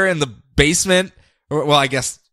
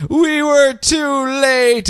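tearful; 12 semitones higher, much slower than usual. Acted. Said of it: "in tears, turns around and goes".

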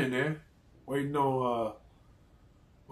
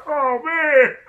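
high, loud mock laughing exclamation; imitated laughing outburst; 13 semitones higher, 9 dB louder than usual. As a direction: exclaim as if cracking up. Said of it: "whole table just bust out laughing".

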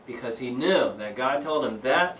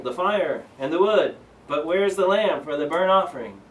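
Isaac voiced higher and louder, asking; a son's honest puzzled question, raised up; curious boy; 6 semitones higher, 6 dB louder than usual. A narrator's reading. Isaac asks his father, read aloud.

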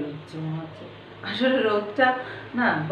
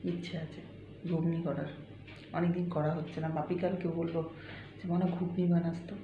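softer, hushed chatting; speaking softly; 3 semitones lower, 9 dB quieter than usual. Real, not acted. Chatting to viewers while Poko sleeps, speaking softly. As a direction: talk low to keep a sleeper asleep. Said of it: "আস্তে আস্তে কথা বলতে হচ্ছে".

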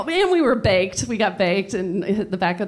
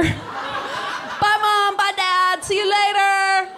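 calling out; 9 semitones higher than usual. Acted. Teenager openly leaving past deaf parents, calling out goodbye.

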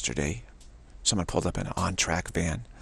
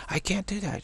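high whiny goddess; 10 semitones higher, 3 dB quieter than usual. Retold or imitated. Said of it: "acted goddess reply".

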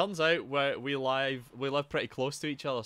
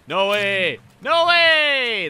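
building to a high, loud yell; louder and higher on each repeat; elated disbelief; 9 semitones higher, 13 dB louder, much slower than usual. Real, not acted. Player amazed at killing both enemies, elated disbelief.

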